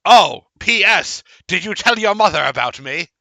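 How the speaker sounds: high, loud squeaky letter reading; creature reading a letter. Acted. Creature reading the vampire host's letter to the party, high and squeaky. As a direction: read out the host's note in the little creature voice.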